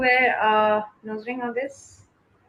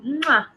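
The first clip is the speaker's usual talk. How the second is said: kiss sound; 5 semitones higher than usual. Real, not acted. Goodnight kiss to viewers.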